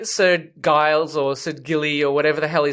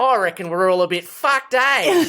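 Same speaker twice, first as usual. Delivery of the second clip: broad, drawn-out seventies Aussie; mock Aussie accent, rising on the final "eh"; 5 semitones higher than usual. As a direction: put on an exaggerated old-school Australian accent. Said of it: "such the seventies Aussie accent".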